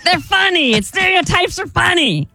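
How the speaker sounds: very high, throat-straining mimicry; host impersonation. Co-host defends himself as the host, high strained imitation. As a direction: squeeze the host's voice up high. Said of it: "you try to do it in my voice".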